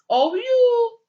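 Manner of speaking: O U called out high and loud; teacher stresses the letters O U for the class; emphatic letters. Shown as a demonstration. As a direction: hit the letters O U hard and high.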